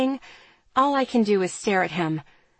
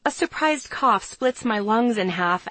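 ordinary delivery, nothing marked

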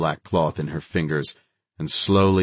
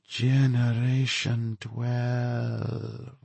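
very slow, stretched and hushed; drawn out; 6 semitones higher, 5 dB quieter, much slower than usual. A narrator's reading. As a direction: draw the words out with menace. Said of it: "She drew the word out".

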